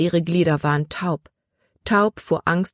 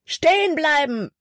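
calling out; 11 semitones higher than usual. Acted. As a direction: yell it at the fugitives. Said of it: "rief Hutton".

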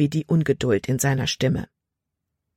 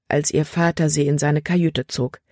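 plain reading voice

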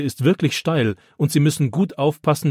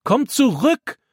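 much higher, louder stern call; stern shout; 13 semitones higher, 3 dB louder, slower than usual. A narrator's reading. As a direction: yell the warning sharply. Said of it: "ruft Opa streng".

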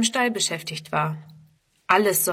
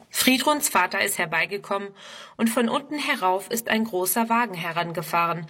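plain reading voice

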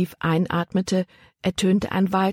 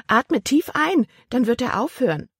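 exasperated aunt; 8 semitones higher than usual. A narrator's reading. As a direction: voice a fussing aunt, pitched well up. Annoyed aunt instructs hiccuping niece.

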